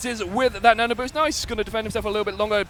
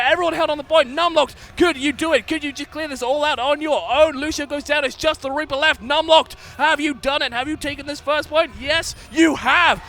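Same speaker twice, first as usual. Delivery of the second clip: excited play-by-play shouting; 7 semitones higher, 5 dB louder than usual. Real, not acted. Caster hyping a clutch point hold.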